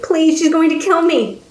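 high, frightened begging; panicked plea to the Count; terrified pleading. Acted. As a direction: plead for your life. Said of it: "Guillaumard begged".

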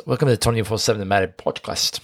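channel the Connery; light Sean Connery voice; Sean Connery impression. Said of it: "Now you sound like Sean Connery".

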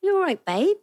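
put-on high, loud Essex accent; playful Essex accent on request. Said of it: "Can you do an Essex accent?".

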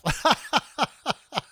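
loud, high, hammy fake laugh; forced fake laugh. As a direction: blurt out an obviously fake laugh. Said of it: "This is where I insert my forced fake laugh".

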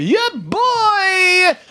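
very high, drawn-out holler; squealed catchphrase echo. Acted. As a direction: echo the hype call in a squeal.

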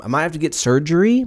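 ordinary delivery

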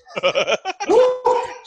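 loud burst of laughter. Real, not acted. Laughing while telling crash story.

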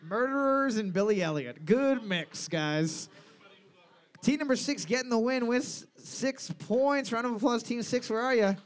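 rousing pub-quiz host. Real, not acted. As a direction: call out the winning team to the room. Quiz host announces the winner.

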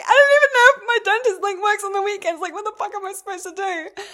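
high, loud, desperate whine; panicked. Retold or imitated. Answering mum on the phone, high frantic panic.

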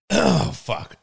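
ordinary delivery

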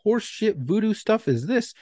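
slow, high-pitched disbelief, not shouted; indignant outrage. Acted. Applicant reacting to the examiner's mind game, high indignant outrage.

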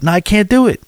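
ordinary talk, nothing marked

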